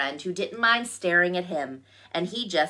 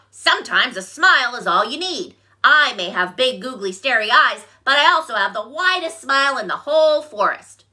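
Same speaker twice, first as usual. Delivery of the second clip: louder cheerful frog; a beaming frog; 5 semitones higher, 6 dB louder than usual. A narrator's reading. Frog explains.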